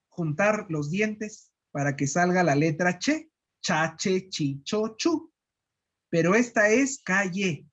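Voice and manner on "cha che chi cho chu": Cha, che, chi, cho, chu with the tongue pulled back and raised. Shown as a demonstration. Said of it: "Viene de adentro". (ch retroflejo desde atrás)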